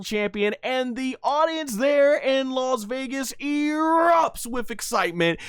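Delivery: high, building call with drawn-out key words; excited ringside announcer. Acted. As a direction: call the title win like a wrestling announcer. Calls the finish like an announcer.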